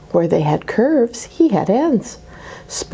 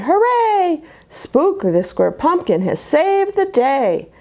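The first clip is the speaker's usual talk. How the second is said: pumpkins cheering; 7 semitones higher than usual. Acted.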